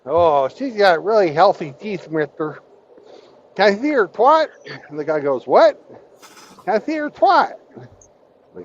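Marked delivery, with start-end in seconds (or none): 0.1-4.5 high, lisping little-man voice with a lisp on "mister"; lisping midget voice. Retold or imitated. Telling the midget joke to Hammy, high lisping midget voice. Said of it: "He doesn't talk too well". 5.5-7.5 high, lisping little-man voice with a lisp on "mister"; lisping midget voice. Retold or imitated. Telling the midget joke to Hammy, high lisping midget voice. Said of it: "He doesn't talk too well".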